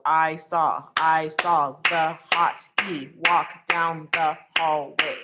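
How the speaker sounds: slow, each second syllable stressed, "I saw the hot E walk down the hallway" as da-dum beats; iambic rhythm demo. Shown as a demonstration. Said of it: "the syllable that you're stressing".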